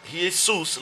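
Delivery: "Jesus" said ee-ay-SOOS; Latin pronunciation. Shown as a demonstration. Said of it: "'cause that's his Latin name".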